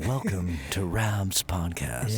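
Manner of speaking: deep, slow announcer intro; slow, low, like a radio host. Pretend. Mock announcer intro, headphones.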